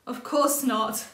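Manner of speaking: a touch of giggle in it; giggling. A narrator's reading. Little rabbit giggling at grandfather's answer, mild giggle. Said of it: "Little Rabbit giggled".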